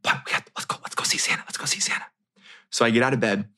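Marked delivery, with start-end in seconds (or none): 0.0-2.0 soft eager whisper. Retold or imitated. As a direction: urge him along in a hush. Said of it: "She's whispering to me".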